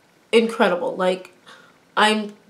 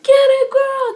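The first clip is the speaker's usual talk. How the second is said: very high, loud fan yell; screaming cheer; 8 dB louder, slower than usual. Retold or imitated. Fan re-enacting cheering on the girl on TV, screaming. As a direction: whoop it at the screen, re-enacting the excitement. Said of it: "I was literally screaming at the T V".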